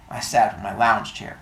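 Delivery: normal talking voice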